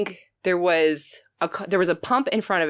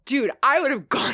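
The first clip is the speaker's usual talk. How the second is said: ordinary talk, nothing marked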